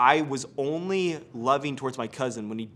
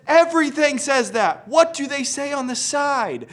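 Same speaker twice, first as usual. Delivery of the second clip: high loud and slowed; impatient excitement; 13 semitones higher, 9 dB louder than usual. Retold or imitated. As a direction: push him for the brand name. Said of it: "And I said".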